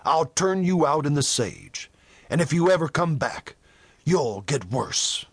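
plain reading voice

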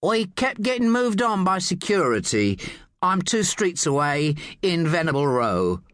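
high-pitched Cockney with slight whine; Cockney driver making excuses; whiny Cockney. A narrator's reading. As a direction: complain in a whining Cockney accent. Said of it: "He had a Cockney accent, but with a slight whine".

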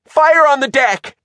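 high, loud warning cry; alarm shout. A narrator's reading. Shivers warns Bob of the fire, shouting. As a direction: sound the alarm at full urgency.

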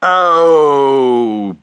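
a big round loud oh. Acted. Father making a noise for Taffy: loud round oh. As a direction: boom the oh out. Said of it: "very loud".

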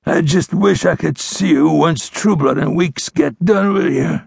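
slow, high, slurred dying voice; weakening, slurred. A narrator's reading. Bleeding outlaw threatening the ranger, weak and slurred. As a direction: let the words smear as life drains. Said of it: "His voice grew weaker, and his words became slurred".

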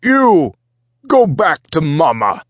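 high, loud Minotaur bellow in broken speech; yelling commander. A narrator's reading. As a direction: yell it as an order at the boy. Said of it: "the Minotaur ship commander yelled at the boy".

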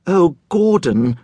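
slow, high, trembling-lipped plea; a touch of fear, drawn out; quivering. Acted. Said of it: "the woman's lips quivered".

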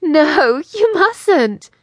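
high, loud fake protest squeal; unconvincing squeal. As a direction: squeal it, not meaning it. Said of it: "The woman squealed unconvincingly".

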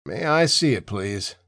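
ordinary delivery, nothing marked

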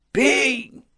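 calls the boatman's name in a high, furious shout; angry shout. A narrator's reading. Pasotti shouting for the late boatman, angry high yell. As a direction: yell it across the windy lake. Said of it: "gridava Pasotti, sempre più arrabbiato".

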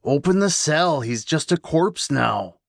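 high, commanding, slowing at the end; insistent sinister call. Acted. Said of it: "louder this time".

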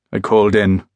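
plain reading voice

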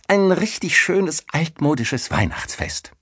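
put-on high, delighted young woman's reply; excited. A narrator's reading. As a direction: announce it eagerly, pitched high. Denise answers, voiced high and eager. (begeistert, mit hoher Stimme)

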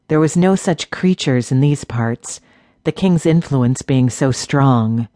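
usual speaking voice

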